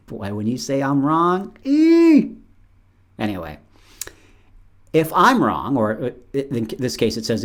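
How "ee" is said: high, loud pained squeal. Real, not acted. Speaker reacting to being told he's wrong, with a high, loud pained squeal. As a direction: squeal like it really stings.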